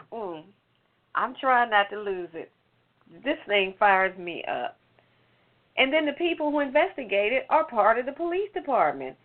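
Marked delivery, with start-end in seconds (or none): none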